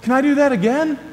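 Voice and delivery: put-on high-pitched, thrilled child voice with a smile; delighted kid. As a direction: play an overjoyed kid begging for another go. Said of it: "gets a huge smile on his face".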